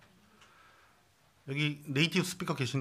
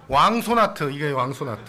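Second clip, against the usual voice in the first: playful shout; 10 dB louder than usual. Real, not acted. Pastor tops the joke for the congregation, loud and playful. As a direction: belt out the bigger joke. Joking gesture name.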